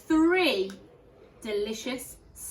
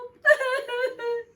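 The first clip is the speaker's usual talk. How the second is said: cub whimper; 8 dB louder than usual. Acted. Baby Bear wailing.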